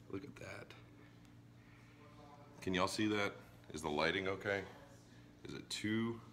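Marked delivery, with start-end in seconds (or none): 0.1-0.7 hushed, breathy whisper of awe. Real, not acted. Host marveling at the dripping burger, whispering. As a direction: breathe it out softly like it's sacred.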